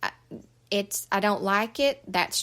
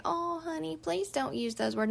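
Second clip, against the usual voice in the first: higher and softer, syrupy mock coddling; mock baby talk; 7 semitones higher, 5 dB quieter than usual. Parent coddling a cursing child, imitating soft baby talk. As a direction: coo it like an overindulgent parent. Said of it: "We're not going to baby talk you and say".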